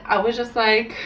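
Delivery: usual speaking voice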